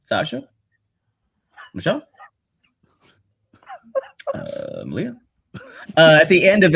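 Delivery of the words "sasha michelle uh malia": lightly voiced Obama, long pauses between names; a hint of Obama listing names; Obama impression. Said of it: "me doing Obama".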